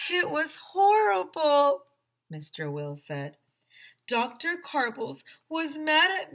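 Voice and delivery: distressed man voice. A narrator's reading. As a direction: recount it shaken. Mister Will tells his ordeal.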